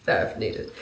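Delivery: "There if needed" whispered, low and unvoiced; whispered aside. Shown as a demonstration. Repeating to viewers under her breath, whispered.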